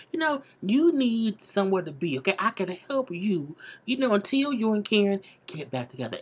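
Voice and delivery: ordinary talk, nothing marked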